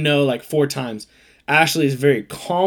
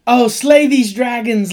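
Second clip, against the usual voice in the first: mock swooning girl; 7 semitones higher, 5 dB louder, slower than usual. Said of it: "the girl's like".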